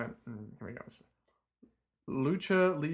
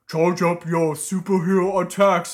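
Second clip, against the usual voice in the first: loud, booming cartoon ogre; 3 semitones higher, 8 dB louder than usual. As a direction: bellow it like a dopey ogre. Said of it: "I was trying to do an ogre voice".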